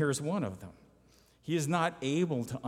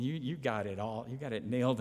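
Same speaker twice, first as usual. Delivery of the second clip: ordinary talk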